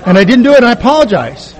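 put-on loud and high, spelling it out like to a child; over-patient explaining through exasperation; exaggerated patience. To his wife, explaining his chore in exaggerated patience. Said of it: "I'm gonna speak slowly".

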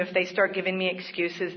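ordinary talk

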